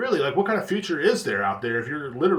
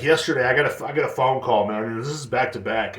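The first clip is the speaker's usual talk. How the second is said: usual speaking voice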